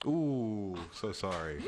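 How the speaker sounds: normal talking voice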